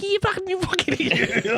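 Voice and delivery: very high, garbled cartoon gibber; Wild Thornberrys' Donnie. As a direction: squeal it like a cartoon wild kid. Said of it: "the Wild Thornberry".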